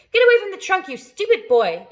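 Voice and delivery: put-on loud, high yell at the boy; angry yell. A narrator's reading. Aunt yells at James to leave the trunk, loud and high. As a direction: bark the order at him. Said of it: "Aunt Spiker yelled".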